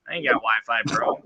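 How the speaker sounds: ordinary delivery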